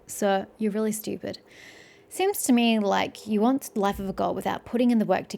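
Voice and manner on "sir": ordinary talk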